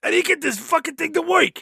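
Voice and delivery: high strained cartoon duck; duck character voice. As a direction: squawk it out frustrated, way up high. Howard quoted in recap.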